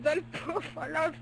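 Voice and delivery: name shouted through a laugh, stretched out. Real, not acted. Reading tennis results, bursts out laughing on the name. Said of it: "Hein, comment?".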